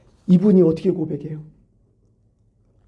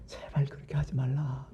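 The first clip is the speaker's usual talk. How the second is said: whisper; 2 semitones lower, 12 dB quieter than usual. Acted. God's plea voiced as whisper.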